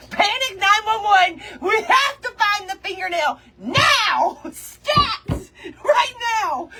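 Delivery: high, loud mock emergency call; mock panic alarm. Hammed-up panic calling everyone to search. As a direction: sound the alarm like a frantic dispatcher.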